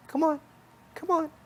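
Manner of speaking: high, squeaky-pitched bunny voice; little bunny rabbit. Acted. Bunny rabbit beckoning the listener to follow, high little voice. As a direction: pitch it way up as a tiny coaxing rabbit. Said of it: "looking at a bunny rabbit saying".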